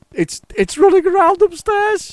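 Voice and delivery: very high, loud mock shriek; imitated panicked shouting. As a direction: imitate a wife shrieking about a spider. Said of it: "She's shouting".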